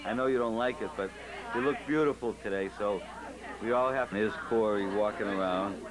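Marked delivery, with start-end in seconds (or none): none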